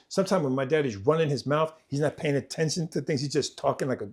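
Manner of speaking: usual speaking voice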